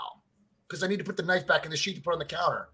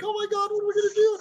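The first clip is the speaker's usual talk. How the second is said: fast, much higher mock panic; imitating panicked wife; much faster than usual. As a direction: rattle it off like a frantic wife. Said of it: "she's like".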